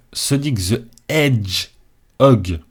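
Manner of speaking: put-on slow, drawn-out English accent; showy English accent. Gamer reading a Sonic title to viewers, showy English accent. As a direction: lay on the English accent, word by word. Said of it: "avec mon superbe accent".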